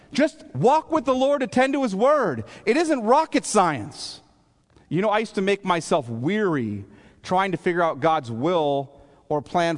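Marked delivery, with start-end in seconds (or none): 0.1-4.2 high-pitched, insistent plea; exasperated exhortation. Real, not acted. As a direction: urge it as obvious, voice pitched way up. Exasperated exhortation after weary philosophers.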